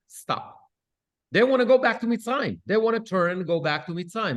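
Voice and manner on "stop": breathy whispered stop; hushed stop. Retold or imitated. Moshe hushing the crying people, whispered. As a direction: hush them with one soft word. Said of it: "Moshe says".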